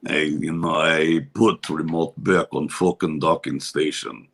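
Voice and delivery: put-on low, slow heavy Russian accent. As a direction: play a gruff Russian mobster. Russian character voice continues.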